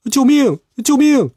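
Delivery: high, urgent yells; panicked cries for help. A narrator's reading. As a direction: scream for help while fleeing. Henchmen flee in terror.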